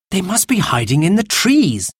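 put-on high, mildly alarmed child; gasped alarm. A narrator's reading. Robert fears hidden Indians, gasping mildly. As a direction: say it with a touch of a gasp. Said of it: "gasped Robert".

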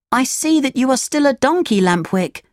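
put-on high puppet boy voice, dropping on the name; Pinocchio, to his friend. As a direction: address an old friend as a little puppet.

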